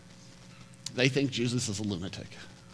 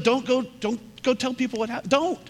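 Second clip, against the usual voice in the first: higher, urgent hush-up; pleading, pitched up; higher mock instruction; 12 semitones higher than usual. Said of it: "he's like".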